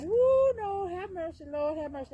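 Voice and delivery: high, loud startled yelp; startled cry. Real, not acted. When the hen lashes out, startled loud cry. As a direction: jump back crying out for mercy. Chicken flaps at her.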